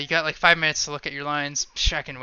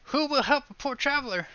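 calling for help; 10 semitones higher, faster than usual. Pretend. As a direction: cry out to anyone nearby. Traveler cries out in play.

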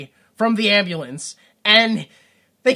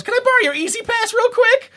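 mock high plea. Imagined hero phoning aunt.

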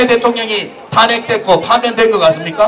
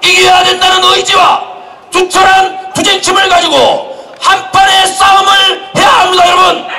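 roaring rallying speech; 5 semitones higher than usual. Real, not acted. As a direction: fire up the crowd.

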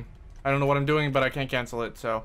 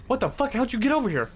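gasp, then a high, shocked question; startled gasp; 10 semitones higher, 3 dB louder, faster than usual. Real, not acted. Gamer startled by an enemy sneaking up, high and shocked. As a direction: jump at the sudden enemy. Enemy suddenly appears behind him.

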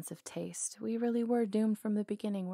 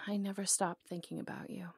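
soft whispered confession; 7 dB quieter than usual. Acted. Outlaw whispering her confession of love to him. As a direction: whisper it, almost ashamed.

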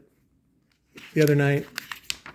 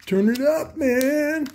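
much higher, put-on dude voice; mock audiophile; 12 semitones higher, much slower than usual. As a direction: ham up a fan begging for volume. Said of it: "You guys are like".

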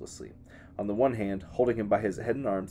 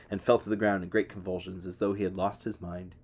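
ordinary delivery, nothing marked

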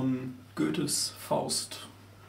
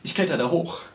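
usual speaking voice

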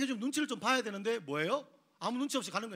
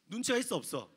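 ordinary talk, nothing marked

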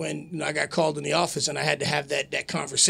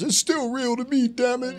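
high, pleading superfan; mock an over-invested fan, pitched up; diehard wrestling fan; 8 semitones higher than usual. Wrestler mimicking a true-believer fan, high mock outburst. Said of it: "he was one of those".

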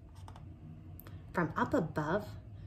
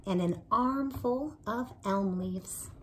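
ordinary delivery, nothing marked

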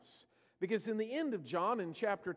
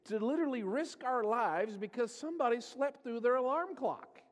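higher, louder mock protest; exasperated disciples; 6 semitones higher, 4 dB louder than usual. Disciples protesting to Jesus about going to Bethany, higher exasperated scoffing. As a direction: scoff at the risky trip. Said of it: "the disciples are like".

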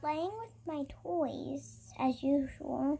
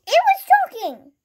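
high, loud amazed cry; excited shout; 12 semitones higher, 13 dB louder than usual. Pretend. Amazed the bird talks.

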